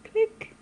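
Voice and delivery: high playful shutter click; camera click sound. Pretend. Mimics camera shutter for photo.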